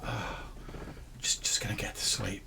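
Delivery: soft, unvoiced murmur, drifting off; mock sleepy whisper; sleepy relaxing. Fry settling to sleep after late prayers, murmuring. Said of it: "you're probably just like".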